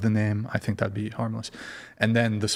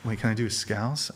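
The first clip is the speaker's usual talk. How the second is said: usual speaking voice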